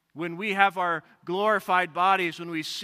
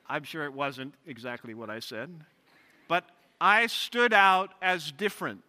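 usual speaking voice

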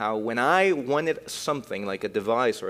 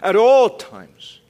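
slow, yearning, louder; voicing the psalmist; 7 semitones higher, 9 dB louder, much slower than usual. Preacher voicing the longing psalmist, slow and yearning. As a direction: ache for the word. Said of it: "It's like".

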